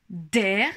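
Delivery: "Der" said alone, stressing the D; isolated syllable. Shown as a demonstration. Teacher isolates the syllable "Der" in "dromadaire". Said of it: "on entend bien le son D".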